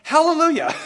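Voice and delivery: a bright, laughing shout of praise; joyful hallelujah. Shown as a demonstration. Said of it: "the right way to respond to that is by saying".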